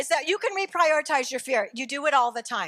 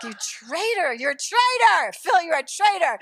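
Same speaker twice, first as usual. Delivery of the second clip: loud, drawn-out playful outrage; mock accusation; 4 semitones higher, 4 dB louder than usual. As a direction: accuse your son with hammed-up betrayal. Mock quarrel with her son.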